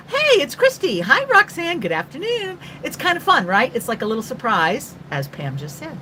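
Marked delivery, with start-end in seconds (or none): 0.0-2.6 high-pitched, gushing mock hello; delighted greeting. Viewer spotting a favorite person pop up live, excited greeting. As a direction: act out happily spotting a friend online. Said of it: "and you're like".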